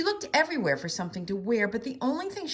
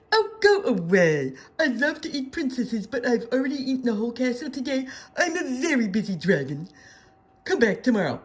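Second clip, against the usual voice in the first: louder, impatient dragon snapping go away; annoyed dragon; 5 semitones higher, 6 dB louder than usual. A narrator's reading. Dragon at the door, dismissing Elizabeth a second time, impatient. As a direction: shoo her off, fed up.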